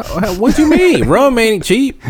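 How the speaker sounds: ordinary talk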